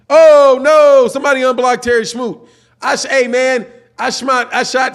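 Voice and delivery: sudden loud high "oh no" in real panic; alarmed outburst. Real, not acted. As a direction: shout it as you realise the mistake. Streamer accidentally blocked a viewer.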